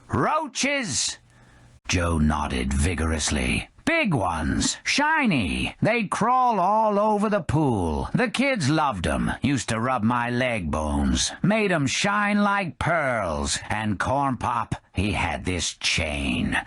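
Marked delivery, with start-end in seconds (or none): 0.1-1.2 high puzzled question; confused drunk. Acted. Drunk Scamala puzzled by Joe's story. 3.8-16.6 put-on rambling old-timer. Skeleton Joe reminiscing to the bar about Corn Pop.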